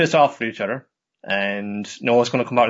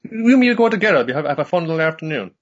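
high, louder girly cartoon voice; hammed-up cheerful Nodoka; 6 semitones higher, 5 dB louder than usual. Nodoka inviting the rabbit to the cafe, high and cheerful. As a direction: chirp it like a cheerful cartoon girl.

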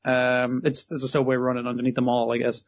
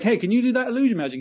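quick, high, mock-eager ask; fast, higher imitation of a keen detective; eager mock question; 9 semitones higher, faster than usual. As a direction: rattle it off like an overkeen guy. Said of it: "he's basically going like".

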